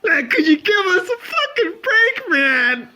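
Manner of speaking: high, crying shout of a fed-up chief; mock wailing police chief. The chief, imagined at his desk, wails in a high crying shout. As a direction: whine it like a broken man begging for a break. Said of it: "That's how the police chief must have been".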